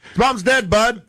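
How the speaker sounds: yelled Canadian heckle. Retold or imitated. Podcaster quoting the heckler, mock Canadian yell. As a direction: shout it up to the stage like a Canadian heckler. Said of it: "when he yells out".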